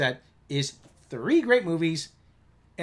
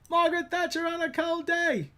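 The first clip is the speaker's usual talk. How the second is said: higher, falling repeat; Austin Powers quote; 11 semitones higher than usual. Retold or imitated. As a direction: say it again even higher. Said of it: "like when Austin Powers is".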